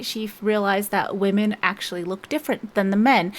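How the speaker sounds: ordinary talk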